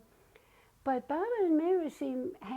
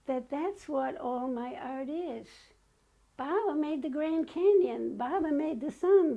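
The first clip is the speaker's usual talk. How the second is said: ordinary talk, nothing marked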